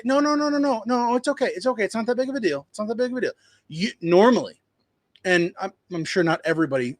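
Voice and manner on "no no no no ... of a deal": high, soothing imitation of someone brushing it off; pitched-up mock reassurance; mock reassuring customer. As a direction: play the customer waving away the apology, pitched up. Customer reassuring after an apology.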